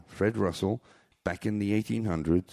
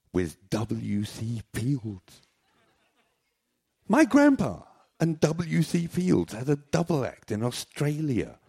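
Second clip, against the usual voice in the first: proud reveal; 7 semitones higher than usual. Real, not acted. Grand reveal about grandpa.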